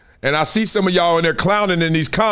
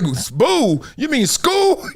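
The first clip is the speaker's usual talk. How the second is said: mock surprise; 7 semitones higher, much slower than usual. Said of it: "I like".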